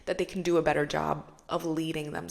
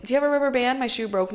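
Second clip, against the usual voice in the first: blunt request; 5 semitones higher, 6 dB louder, faster than usual. Retold or imitated. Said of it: "Two totally different approaches".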